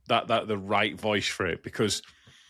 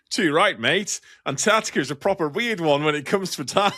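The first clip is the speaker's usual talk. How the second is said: higher-pitched imitation of an Australian assistant; Australian accent; 4 semitones higher than usual. Google assistant answering about Antarctica, Australian accent. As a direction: put on a hearty Australian accent. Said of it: "when my Google was Australian".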